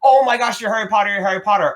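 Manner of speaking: high, loud, excited squeal; imitating a thrilled fan; freaking out. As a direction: gush like a starstruck bakery lady. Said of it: "this lady freaked out".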